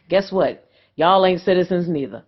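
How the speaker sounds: ordinary talk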